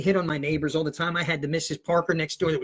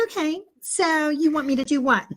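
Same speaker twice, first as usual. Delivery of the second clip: high put-on mother; fake mom voice; 9 semitones higher than usual. Teen plays his mother answering the teacher, high-pitched voice. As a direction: impersonate Mom taking the phone. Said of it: "I got the voice down pat".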